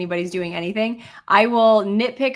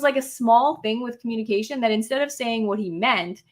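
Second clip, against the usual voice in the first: normal talking voice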